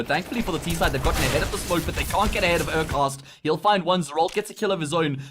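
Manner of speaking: play-by-play. Real, not acted. Live play-by-play casting.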